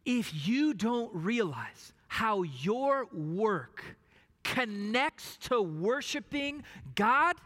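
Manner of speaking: usual speaking voice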